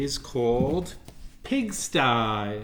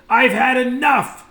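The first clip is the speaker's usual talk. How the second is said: much higher, loud exasperated cry; boy shouting he's had enough of the pigs; fed-up shout; 10 semitones higher, 12 dB louder than usual. Acted. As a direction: let it burst out, totally done.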